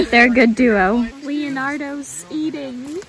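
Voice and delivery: ordinary talk, nothing marked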